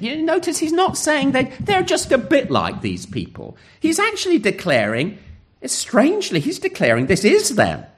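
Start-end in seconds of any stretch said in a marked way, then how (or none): none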